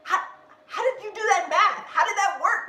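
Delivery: retelling a shocked laugh at the accountant; incredulous laughing. Retold or imitated. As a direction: laugh in disbelief at the math. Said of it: "And I was like".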